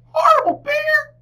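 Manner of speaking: very high tiny squeak of the name; squeaking bat. A narrator's reading. Bat squeaking at Bear, tiny high voice. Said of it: "Bat squeaked".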